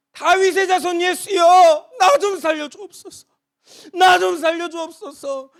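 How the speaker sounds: high, tearful, slow begging cry; anguished plea. Acted. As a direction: beg for rescue through tears. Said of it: "간절한 부르짖음". (울먹이며 살려달라고 부르짖는 소리)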